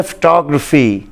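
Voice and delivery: फोटोग्राफी as fuh-TOG-ruh-fee, stressed TOG; फोटोग्राफी slow and careful, reduced vowels; फोटोग्राफी English style; correct English pronunciation. Shown as a demonstration. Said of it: "तवज्जो से सुन लें".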